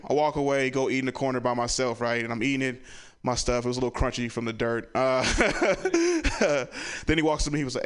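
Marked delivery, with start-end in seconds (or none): none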